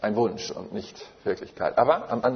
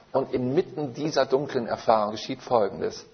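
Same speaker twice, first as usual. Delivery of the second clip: ordinary delivery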